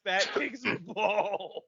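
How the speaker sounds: slow, laughing through the words; breaking into a laugh mid-phrase. Real, not acted. Impressed by the fake Scottish accent story, laughing slowly.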